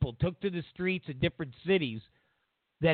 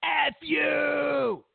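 high yelled insult, hammed up; mock shout; 9 semitones higher, 4 dB louder, much slower than usual. Mocking liberals, he yells the insult high and loud. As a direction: yell it like an outraged mob. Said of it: "They'd be like".

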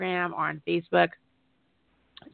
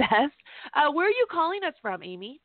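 usual speaking voice

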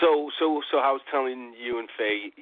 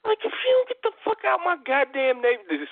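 angry New Yorker. Retold or imitated. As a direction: yell it like a local roasting her. Voicing locals roasting reviewer.

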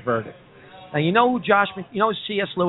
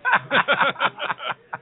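a high, burst of real laughter; big laugh; 9 semitones higher than usual. Real, not acted. Laughs at the joke.

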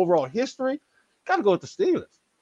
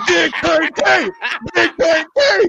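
high, loud mock announcement of a star; jokey over-the-top cheer; hammed-up hype shout; 10 semitones higher, 4 dB louder, slower than usual. Repeats mock hype over laughter.

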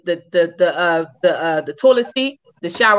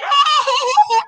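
high burst of laughter. Real, not acted. Laughing at own story.

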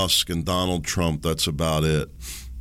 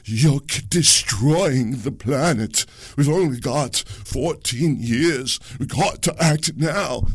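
slow, higher mock-indignant activist; Greta Thunberg impression; 8 semitones higher, 3 dB louder, slower than usual. Host mocking a young activist's warning, slow, high and scolding. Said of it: "that's my best Greta, Greta impersonation".

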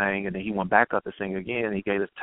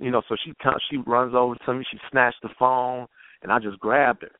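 ordinary delivery

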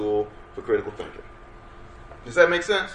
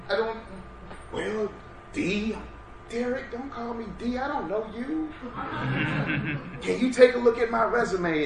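job seeker; 9 semitones higher, 4 dB quieter than usual. Retold or imitated. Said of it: "people who said they wanted".

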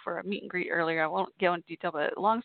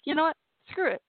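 defiant resolve; 7 semitones higher, 3 dB louder, faster than usual. Retold or imitated. Deciding to post the photo.

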